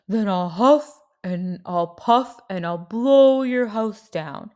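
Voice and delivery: loud, menacing wolf voice; big bad wolf. Wolf threatens the pigs through the door, loud wolf voice.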